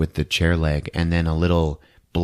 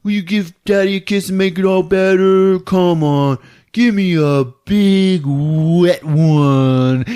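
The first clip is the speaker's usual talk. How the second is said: high, loud, drawn-out coaxing; wheedling baby talk; 12 semitones higher, 7 dB louder, slower than usual. Retold or imitated. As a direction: beg for a kiss in sing-song baby talk. David wheedles his wife for kisses.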